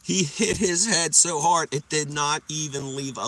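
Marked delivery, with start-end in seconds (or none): none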